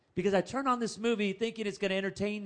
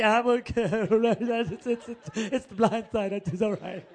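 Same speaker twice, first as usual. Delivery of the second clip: embarrassed laughing reassurance; laughing through tears; slower than usual. Retold or imitated. To the congregation, laughing at his own tears on the flight. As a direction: brush it off while laughing.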